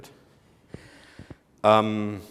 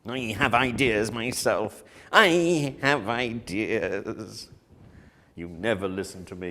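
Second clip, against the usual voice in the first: 1 mock angry actor; slower than usual. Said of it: "[name] is going to get very angry".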